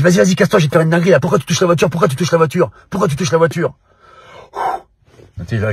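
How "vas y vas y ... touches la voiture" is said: high, loud repeated furious demand; aggressive shouting. Retold or imitated. Stranger yells at Ragnar for touching his car, loud and aggressive. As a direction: hammer the question like a threat. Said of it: "Il me dit".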